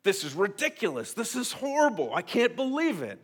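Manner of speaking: indignant rant at home to his wife; exasperated outrage. Retold or imitated. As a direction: vent like you can't believe the news. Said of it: "I say this kind of stuff".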